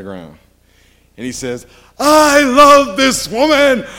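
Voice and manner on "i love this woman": loud slow shout, pitched way up; man yelling his love in the courtyard; loud declaration. Retold or imitated. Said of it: "he starts yelling".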